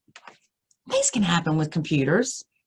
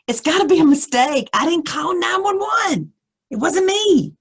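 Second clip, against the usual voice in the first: much higher, loud insistent shout; frustrated protest; 11 semitones higher, 6 dB louder than usual. Retold or imitated. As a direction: shout your innocence down the phone. Protesting to the 911 operator.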